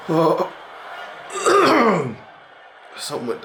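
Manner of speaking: strangled choked voice; choking. Real, not acted. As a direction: barely get it out, choking. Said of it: "I can't speak".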